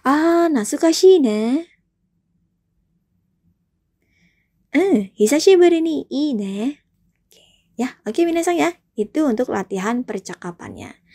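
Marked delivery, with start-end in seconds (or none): 0.0-6.8 raised, slow wistful reply; teacher playing B in the drill; role B in drill. Shown as a demonstration. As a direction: play B warmly. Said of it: "saya sebagai B".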